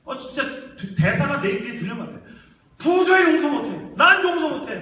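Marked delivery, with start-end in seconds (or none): 2.8-4.8 high, loud cry of unbearable rage; acted anguished fury; enraged father shouting. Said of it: "들판을 누비면서 막 소리 지르는".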